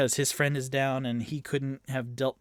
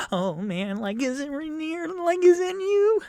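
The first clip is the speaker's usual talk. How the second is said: high, panicked whimper climbing louder; shaking in fear; 6 dB louder than usual. Acted. Game master voices curled-up Floon asking for Rainier, terrified. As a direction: rise into a high, frightened plea. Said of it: "curled up fetal position and just shaking violently".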